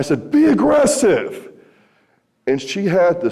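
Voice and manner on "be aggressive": yelled command. Retold or imitated. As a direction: bellow it across the field like a coach. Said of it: "And I yell".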